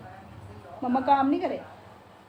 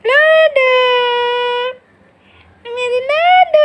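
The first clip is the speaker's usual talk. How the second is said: very high, loud doting coo; cooing endearment; 10 dB louder, much slower than usual. Real, not acted. Mother cooing over her pet parrot on her lap, very high. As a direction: croon the pet name up high.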